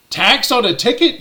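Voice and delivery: retold incredulous outburst; incredulous question. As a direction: question it as if it's absurd. Retold disbelief at the clerk.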